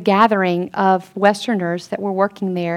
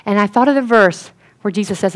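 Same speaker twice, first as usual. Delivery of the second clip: ordinary talk, nothing marked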